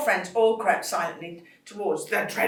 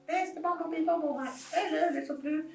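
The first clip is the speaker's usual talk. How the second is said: high puppet voice greeting; chirpy little puppet saying hello; high, friendly puppet; 10 semitones higher, 4 dB quieter than usual. Pretend. Puppet voice greets, far timbre.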